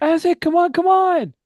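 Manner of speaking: very high, loud urging shout; urgent call. Acted. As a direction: yell for a companion to hurry, voice leaping high. Repeats in-character call to Aztec.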